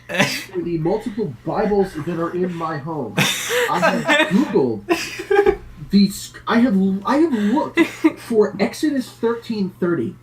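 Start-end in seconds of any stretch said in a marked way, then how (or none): 3.2-10.2 buzzy glitchy call audio; choppy robotic connection. Real, not acted. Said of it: "You, you've gone full robot".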